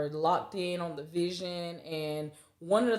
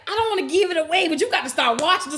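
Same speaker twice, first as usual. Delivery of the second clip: excited; 10 semitones higher, 8 dB louder than usual. Retold or imitated. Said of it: "I'm like".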